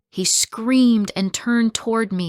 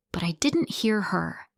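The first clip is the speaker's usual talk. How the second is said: plain reading voice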